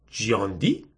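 low, soft, rising question; puzzled question. Acted. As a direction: repeat the name quietly, unsure. Lou puzzled by the name. (سؤالی آرام و بم با تردید)

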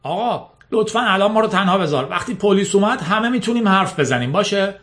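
lightly muffled plea, as if through a Kleenex; strained from under a tissue. A narrator's reading.

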